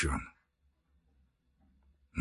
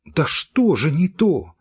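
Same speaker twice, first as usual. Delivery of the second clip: bewildered; 5 semitones higher, 5 dB louder, slower than usual. Acted. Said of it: "в недоумении говорила Варенька".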